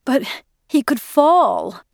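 high, drawn-out anxious protest. A narrator's reading. Mother fearing for son up a tree, protesting. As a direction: protest with pitch soaring and words stretched. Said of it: "protested Edie".

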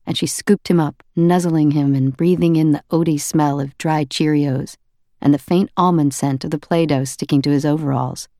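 usual speaking voice

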